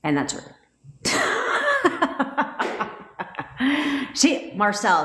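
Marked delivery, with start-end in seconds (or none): 1.0-4.1 high burst of real laughter; long laugh. Real, not acted. Coach cracking up after her own rant, long laugh.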